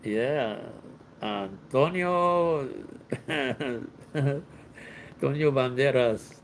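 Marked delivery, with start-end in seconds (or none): 1.2-6.3 slow, stretched-out, savoring each syllable; drawn-out name. Pretend. Teasing Rosa about her Spanish name, drawn-out. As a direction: linger on the name like a grand announcement.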